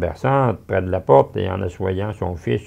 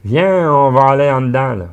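calling out; 6 semitones higher, 9 dB louder than usual. Acted. Matron calls them inside.

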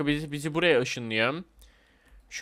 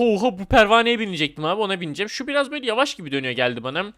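ordinary talk, nothing marked

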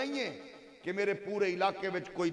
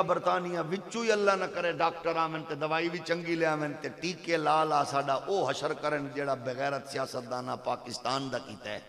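ordinary talk

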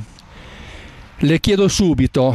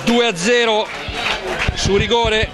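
excited commentary; 7 semitones higher than usual. Real, not acted. Goal call continues.

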